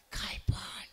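breathy secret whisper, slow; whispered girlfriend voice. Acted. Girlfriend whispering to her lover at night, slow whisper. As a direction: whisper like a girlfriend hiding under the sheet.

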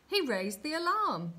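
high, loud answer from the crowd; eager shout. A narrator's reading. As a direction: call it out excitedly. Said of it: "shouted another".

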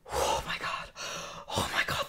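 breathless whispered oh my god under the breath; panicked whisper. Retold or imitated. At the dinner table after her cousin recognized her, panicked whisper. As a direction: whisper it to yourself in rising panic.

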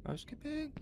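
high sing-song housekeeping call; mock housekeeping call. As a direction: call out like hotel staff. Calling out into empty house.

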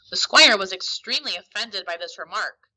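usual speaking voice

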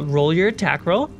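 ordinary talk, nothing marked

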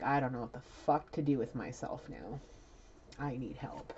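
low, sinking voice of someone lost; retold plea, dropping low and soft; quiet despair. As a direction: let it sink to a weary murmur. Channels despairing man drinking on floor.

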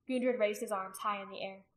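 plain reading voice

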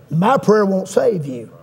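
normal talking voice

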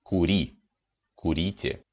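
Кури and курите, stress on the и ending. Shown as a demonstration. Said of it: "the stressed imperative ending is always «и»".

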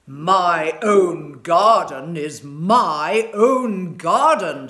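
very slow, loud, possessive giant; gruff giant. A narrator's reading. Giant claiming his garden, slow and loud. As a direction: stretch out each word like a greedy giant. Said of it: "said the giant".

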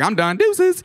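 high, offhand mock sign-off; breezy quitting. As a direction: toss it out like a carefree quitter. Acts out breezily quitting a job.

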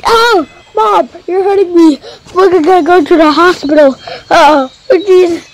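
put-on high, fast whining kid; a hurt kid complaining to mom; whiny kid.

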